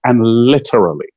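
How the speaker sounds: normal talking voice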